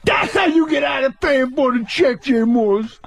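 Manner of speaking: high, proud street voice; high and pleased with himself; Tracy impression. As Tracy after being thrown out, high and proud.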